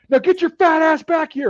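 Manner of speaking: high, loud order; imitated sergeant yell. Instructors calling recruit back over monkey bars, imitated yell.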